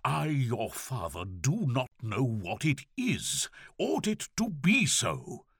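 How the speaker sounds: harsh, overwrought. A narrator's reading. Father demanding his daughter's hidden sorrow, harsh and overwrought. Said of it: "His harsh voice shook, as if something had snapped".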